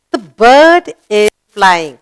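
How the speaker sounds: slow, loud, every word separate; slow model reading. Shown as a demonstration. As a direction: read it out slowly for the class to copy. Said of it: "Shall we read the sentence?".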